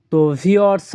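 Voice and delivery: ordinary delivery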